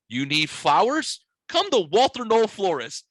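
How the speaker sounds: high, loud mock commercial voice. Telling a friend about the TV ad, hammed-up announcer voice. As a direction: ham up the florist ad pitch. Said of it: "in fucking tears laughing at the".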